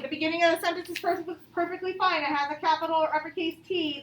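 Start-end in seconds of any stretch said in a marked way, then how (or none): none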